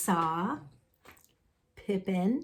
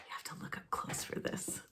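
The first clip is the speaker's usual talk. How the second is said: fast hushed whisper; whispered aside to the listeners; 17 dB quieter, faster than usual. Real, not acted. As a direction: lean in and whisper it.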